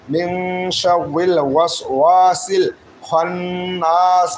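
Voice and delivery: slow recitation, holding the nasal n in খন্নাস; held ghunna. Shown as a demonstration. Teacher shows the ghunna rule, humming the n in খন্নাস. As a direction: draw out the doubled n of খন্নাস with a hum. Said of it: "নুনের তাজদিদ থাকার কারণে এখানে এক আলিফ গুণনা করা ওয়াজিব".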